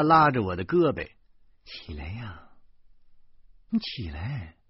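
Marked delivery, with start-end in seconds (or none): 1.6-4.6 soft urging. Acted. Teacher coaxes man to get up.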